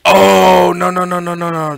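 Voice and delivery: sudden loud oh, then rapid no's; surprised. Real, not acted. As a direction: catch your own mistake out loud. Realizes the deck is wrong.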